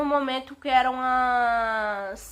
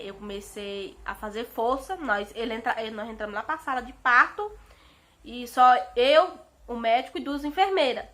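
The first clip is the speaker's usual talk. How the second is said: ordinary talk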